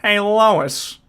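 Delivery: a goofy cartoon dad pitching an idea; Peter Griffin impression. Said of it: "Can you do Peter Griffin?".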